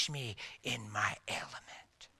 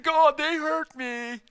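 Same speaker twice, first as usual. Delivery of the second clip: high, slow whining; mock a whining believer; whiny complainer; 12 semitones higher, 7 dB louder, slower than usual. Preacher mocking a complaining believer, whiny.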